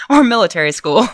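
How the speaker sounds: laughing at her own joke. Real, not acted. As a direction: toss off the joke and giggle. Reader jokes and laughs.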